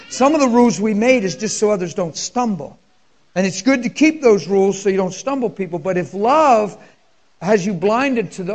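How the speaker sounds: loud, high fervent preaching; full-force preaching. Acted. Preacher to congregation on love breaking rules, fervent preaching. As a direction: preach it hard to the room.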